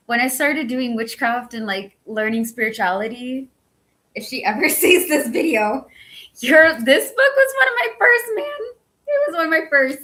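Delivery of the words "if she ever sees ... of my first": squealing high with excitement; excited gush. Real, not acted. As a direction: gush over the book like a fan. Gushing over favourite author's book.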